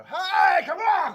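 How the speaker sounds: loud, high shout to shut them up. Acted. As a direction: bellow it over the crowd. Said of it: "just shout and go".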